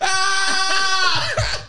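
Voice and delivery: high loud excited yelp; joyful shriek. Retold or imitated. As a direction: let out a thrilled scream. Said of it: "I was like".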